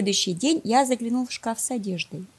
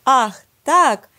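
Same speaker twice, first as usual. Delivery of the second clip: put-on a loud, angry kid's yell; boy losing it and yelling at his brother, loud; yell at the top of his lungs; 3 semitones higher, 6 dB louder than usual. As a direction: yell it out in a flash of temper. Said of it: "как заору во всё горло".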